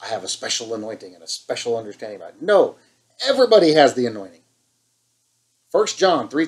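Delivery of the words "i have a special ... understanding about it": quick, low-key mocking of a know-it-all; smug mock voice. Preacher mimicking people who claim special insight, quick smug mockery. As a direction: toss it off like a self-important believer, a bit faster. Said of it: "it makes them feel good about themselves".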